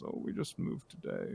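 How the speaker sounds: put-on old-man mumble. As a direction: trail into a quiet, confused mumble. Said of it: "and mumbles".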